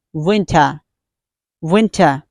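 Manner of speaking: "winter" as WIN-tah, no R; British pronunciation. Shown as a demonstration. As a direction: drop the R at the end of "winter". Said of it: "British people don't sound R".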